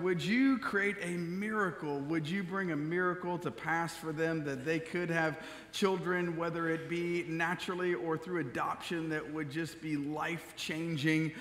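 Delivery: soft, hushed opening prayer. Real, not acted. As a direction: pray quietly and earnestly to the Lord. Opening prayer before sermon.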